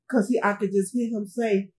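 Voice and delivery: ordinary talk, nothing marked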